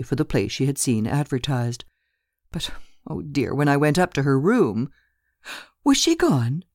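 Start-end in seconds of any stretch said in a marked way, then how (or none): 5.8-6.6 sudden high question; bursting in. Acted. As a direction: cut in, pitched up and eager. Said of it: "burst in Lena".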